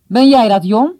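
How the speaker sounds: loud, high, surprised question; astonished. A narrator's reading. Father finds his son in the house, astonished. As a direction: call out in amazement. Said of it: "hoorde die vader verwonderd vragen".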